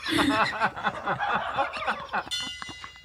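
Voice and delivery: long helpless giggle; laughing. Real, not acted. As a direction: crack up softly and keep going. Cracking up at the pumpkin.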